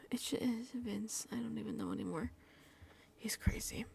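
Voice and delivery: whispered, giving up; weary whisper. Real, not acted. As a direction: breathe it out in defeat.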